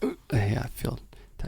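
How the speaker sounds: a breathy, tense little whisper; half-joking hushed clench; mock tight-gut whisper. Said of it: "He's like".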